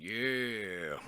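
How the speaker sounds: low, drawn-out monster voice; rock monster. Acted. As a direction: answer as a lumbering rock monster. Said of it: "big old rock monster looking on".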